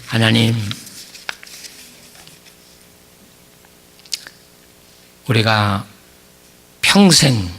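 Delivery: earnest prayer with long pauses between phrases; closing prayer. Acted. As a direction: pray humbly before the congregation.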